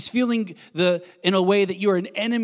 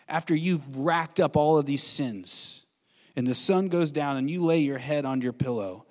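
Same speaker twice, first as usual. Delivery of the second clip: normal talking voice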